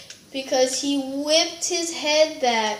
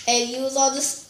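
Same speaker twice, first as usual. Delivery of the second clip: usual speaking voice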